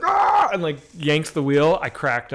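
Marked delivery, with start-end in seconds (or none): 0.0-0.5 sudden high yelp imitating a scream; a short imitated shriek; retold Al Pacino yell. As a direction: burst out with a mock yell. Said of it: "he just gets like".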